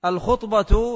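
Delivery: الخطبة said al-khutba, with u after خ; damma on خ. Shown as a demonstration. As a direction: say الخطبة with a short u on the خ. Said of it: "بضم الخاء".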